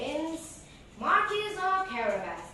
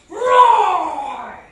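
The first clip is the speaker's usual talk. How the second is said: loud, higher roar; lion roar; 6 semitones higher, 18 dB louder than usual. Acted. Ogre turned lion, roaring at Puss. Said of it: "The ogre roared".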